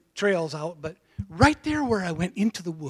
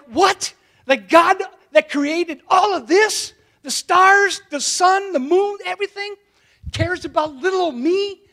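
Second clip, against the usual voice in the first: much higher and louder, slowing in wonder; amazed disbelief; 10 semitones higher, 6 dB louder than usual. Retold or imitated. Boy hearing he matters, calling out in astonished wonder. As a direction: cry out in stunned amazement. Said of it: "And I'm like".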